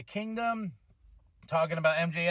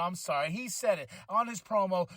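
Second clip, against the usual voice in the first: normal talking voice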